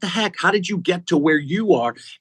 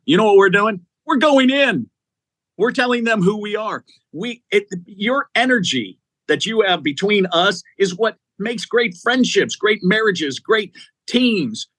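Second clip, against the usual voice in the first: motivational push; 7 semitones higher than usual. Real, not acted. Motivational rallying to listeners.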